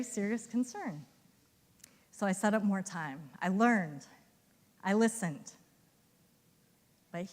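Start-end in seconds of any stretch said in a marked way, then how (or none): none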